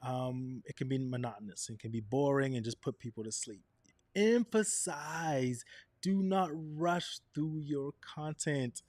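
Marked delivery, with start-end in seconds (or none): 4.1-8.8 slow, emphatic pacing; slow and deliberate. Shown as a demonstration. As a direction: take it slow to make it land. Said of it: "I'm slowing down".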